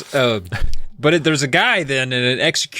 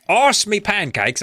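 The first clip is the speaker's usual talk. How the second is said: mock British slang; 7 semitones higher, slower than usual. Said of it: "I don't know what they say over there".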